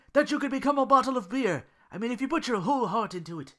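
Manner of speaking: high, loud earnest character voice; Duke's earnest plea. Acted. Duke earnestly urging Belle to believe, in a high eager voice. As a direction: plead it wide-eyed and sincere.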